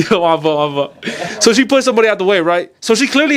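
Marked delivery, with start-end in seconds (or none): 0.1-1.4 giggling through a quick sorry; chuckling my bad; laughing apology. Real, not acted.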